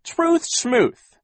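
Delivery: high, loud scoff on Truth schmooth; dismissive villain. A narrator's reading. Captain Sham scoffing at Klaus. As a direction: brush the truth aside.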